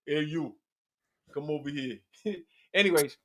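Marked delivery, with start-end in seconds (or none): 0.1-2.0 low, quiet TV character impression; Beastie. Comic imitating the man from Oz, low quiet voice. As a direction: drop deep and summon someone softly. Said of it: "That motherfucker be like this".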